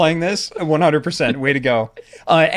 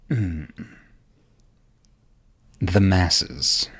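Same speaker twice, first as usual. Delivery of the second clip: low disdain; 7 semitones lower, much slower than usual. Acted. Vampire realizes they are amongst mortals, low disdain. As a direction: murmur it low, with long disdainful pauses.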